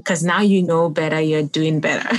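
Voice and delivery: ordinary delivery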